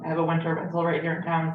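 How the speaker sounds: usual speaking voice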